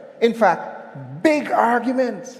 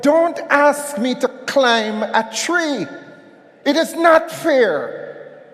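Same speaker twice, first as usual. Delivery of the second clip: high, slow shout of indignation; real frustration at managers, shouted; vexed shout; 6 semitones higher than usual. Real, not acted. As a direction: let it burst out, vexed. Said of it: "I'm vexed".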